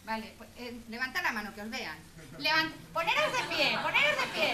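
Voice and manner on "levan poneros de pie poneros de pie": loud, high call across the room; rally the whole room to their feet; loud call to stand. Real, not acted. Teacher calling loudly to the workshop audience to stand up, loud call.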